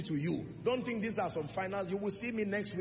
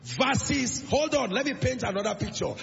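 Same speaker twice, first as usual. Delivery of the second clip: loud, driving sermon delivery; full-force preaching; 4 semitones higher, 8 dB louder than usual. Real, not acted. Preacher painting the wicked man's end for the congregation, full-force preaching. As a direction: preach it hard, building to a peak on "finally".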